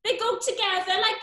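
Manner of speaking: a lightly put-on South Wales accent; lilting South Wales Brenda, worried. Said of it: "that's 'cause I'm from South Wales".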